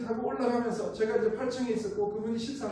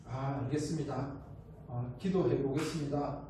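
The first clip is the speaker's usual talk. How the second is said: low, soft, subdued polite dismissal; noncommittal tired chairman; 7 semitones lower, 5 dB quieter than usual. Retold or imitated. Chairman opening his eyes after the pitch, low noncommittal reply. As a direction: lightly act a weary executive's brush-off. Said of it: "이사장님이 이제 눈을 뜨시더니".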